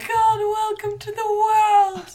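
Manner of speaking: mock adoring parent. New parent welcoming the avocado baby, gushingly adoring. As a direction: gush over a newborn, then go tender. Acting a parent greeting newborn.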